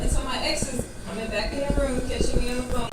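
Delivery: ordinary talk, nothing marked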